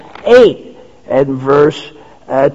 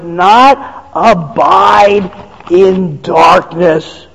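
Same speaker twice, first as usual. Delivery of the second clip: rising, louder preacher cadence, slow on the quotes; preaching at full force; 2 semitones higher, 3 dB louder than usual. Acted. Preacher proclaims to the congregation, fervent and rising. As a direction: build the list like a pulpit crescendo.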